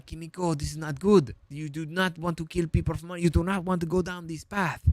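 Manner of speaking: pleading in a put-on French accent; earnest mock French accent; Frenchie. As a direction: persuade with a hammed-up French accent. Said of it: "he basically tries to convince her".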